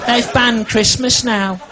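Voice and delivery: shrill grandma in full indignation; outraged nan. Retold or imitated. Nan grumbling to grandson Stu, high squeaky outrage.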